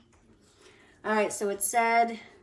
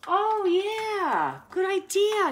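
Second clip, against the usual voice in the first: high, loud burst of approval; excited agreement; 10 semitones higher, 7 dB louder, much slower than usual. Real, not acted. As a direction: jump on the tip with real enthusiasm. Delighted response to a viewer's tip.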